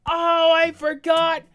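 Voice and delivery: high, loud, wailing rage at the game; anguished rage. Real, not acted. Gamer wailing at his lost save, furious. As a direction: howl it out, furious and despairing.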